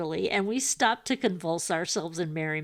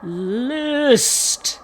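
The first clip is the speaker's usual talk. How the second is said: high, loud, drawn-out command; booming ghostly order; 8 semitones higher, 9 dB louder than usual. A narrator's reading. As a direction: call it out like a commanding spirit. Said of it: "Homer commanded".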